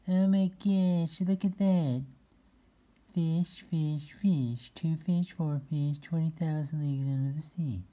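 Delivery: high excited cat voice; talking cat voice. Acted. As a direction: squeak it out like a thrilled cat. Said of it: "my impression of a cat talking".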